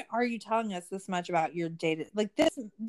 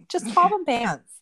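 laughing it out, a bit higher and louder; 6 semitones higher, 7 dB louder than usual. Real, not acted. Laughing at her own joke about slacks, laughing. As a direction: giggle through the words.

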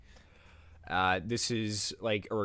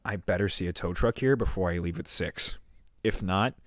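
low-pitched, firm threat; retold ultimatum; 3 semitones lower, 6 dB louder than usual. Demanding a tow truck by six, low stern ultimatum. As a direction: lay down the law, building louder at the end.

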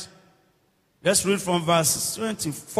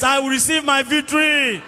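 with confidence; 3 semitones higher than usual. Shown as a demonstration. Preacher leading the congregation to claim victory, confident declaration. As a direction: claim it boldly, stretching VICTORY. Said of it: "Say it with confidence".

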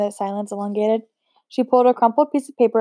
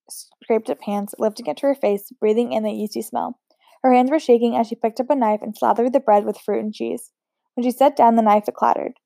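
ordinary delivery, nothing marked

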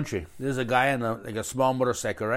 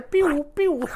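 high pew pew shot noises; silenced gunshot; 14 semitones higher, 3 dB louder than usual. Shown as a demonstration. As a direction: make the high zap of a silenced gun. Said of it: "and it's a silencer".

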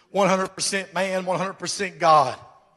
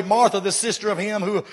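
ordinary talk

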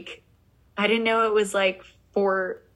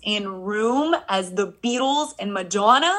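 amazed disbelief; 4 semitones higher than usual. Real, not acted. Stunned at [name] news.

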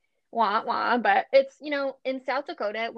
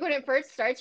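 normal talking voice

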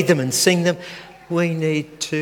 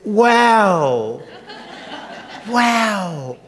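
put-on high delighted toddler wow; grandson's wow; 5 semitones higher than usual. Grandfather imitating his grandson's delighted wow. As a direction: gasp it like an amazed little boy. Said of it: "but he says".

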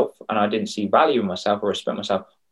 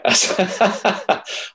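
laugh; 11 semitones higher than usual. Real, not acted. Laughs about secret spa day.

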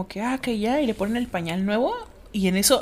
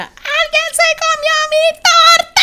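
put-on high, slow furious kid's yell; mock kid's shout about the torta; 10 dB louder, much slower than usual. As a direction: scream it like the kid at the fridge. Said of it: "abre el refrigerador y grita".

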